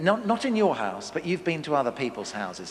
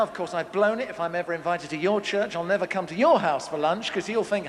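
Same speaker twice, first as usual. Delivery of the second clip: ordinary delivery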